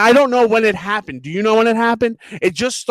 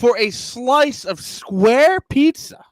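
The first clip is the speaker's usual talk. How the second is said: slow, raised, word-by-word disbelief; incredulous outrage; 6 semitones higher, much slower than usual. Real, not acted. Customer complaining about a square pizza slice, incredulous outrage. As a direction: spell out the absurdity slowly, pitched up.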